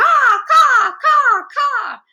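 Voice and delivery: loud, very high eagle cawing; screaming eagle. A narrator's reading. Sammy the eagle riling up the crowd, screaming caws. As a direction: screech each caw like an eagle mascot. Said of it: "the screaming eagle".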